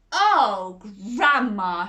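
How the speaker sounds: loud surprised gasp of grandma; shocked exclamation. Acted. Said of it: "she gasped".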